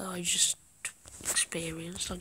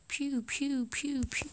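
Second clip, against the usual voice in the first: high pew pew blaster sounds; gunfire noises; 7 semitones higher, slower than usual. Acted. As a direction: make toy laser-gun noises. Imitating gunfire.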